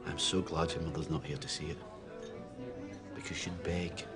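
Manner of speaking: very low, hushed bitterness; quiet bitter reproach. Acted. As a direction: condemn your son under your breath, heavy with hurt. Grieving father rebukes silent son.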